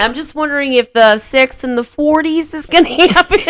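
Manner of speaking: high, bursting into laughter; laughing. Real, not acted. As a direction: laugh through the words. Laughs at her own joke.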